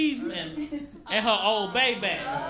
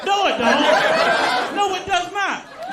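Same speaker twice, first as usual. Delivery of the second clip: slow, loud indignant no; no, it don't, stretched out in disbelief; outraged denial; 6 semitones higher, 5 dB louder, much slower than usual. Acted. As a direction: refuse it flatly, drawn out and loud.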